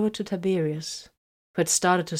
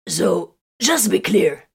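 pointed; 2 semitones higher, 8 dB louder, slower than usual. A narrator's reading. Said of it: "Corvin recounted".

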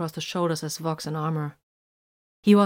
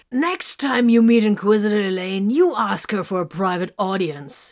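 bawdy advice; 3 semitones higher, 6 dB louder than usual. A narrator's reading. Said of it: "he advised".

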